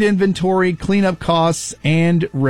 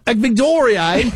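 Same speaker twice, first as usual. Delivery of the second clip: a hint of Australian accent; 5 semitones higher than usual. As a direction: say it like an Aussie. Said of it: "make them more Australian".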